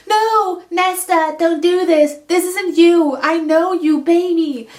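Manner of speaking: high, loud desperate pleading; pleading cry. Retold or imitated. Feyre begging her sister to stop the fight, loud high plea.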